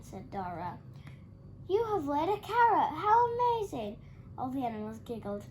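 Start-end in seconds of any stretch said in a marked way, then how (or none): 1.7-4.0 high, loud astonished joy; amazed delight. A narrator's reading. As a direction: marvel at the carrot. Dora's delighted discovery.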